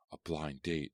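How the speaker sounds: usual speaking voice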